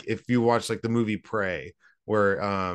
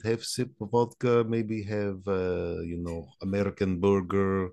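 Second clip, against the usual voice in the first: put-on comrade host with a thick Russian accent. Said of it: "My, my Russian accent".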